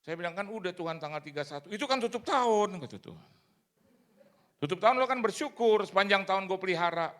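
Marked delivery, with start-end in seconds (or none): 0.3-2.8 high, loud voice of God scolding; God's voice. Retold or imitated. God correcting the preacher who skipped church, high loud scolding. Voicing God answering him. 4.6-7.1 high, loud voice of God scolding; God's voice. Retold or imitated. God correcting the preacher who skipped church, high loud scolding.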